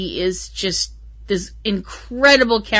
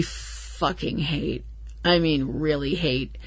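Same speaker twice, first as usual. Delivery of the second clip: low seething hate; 5 semitones lower, 11 dB quieter, slower than usual. Real, not acted. Venting about hated character.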